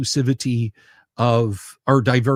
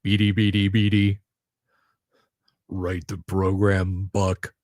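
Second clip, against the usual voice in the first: a weak stab at Twiggy's voice; Twiggy impression; 6 semitones lower than usual. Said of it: "the worst Twiggy impression".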